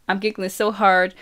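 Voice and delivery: ordinary delivery, nothing marked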